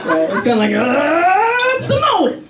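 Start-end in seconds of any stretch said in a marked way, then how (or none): 1.8-2.4 shouted reveal. Acted. Genetic Yahtzee punchline.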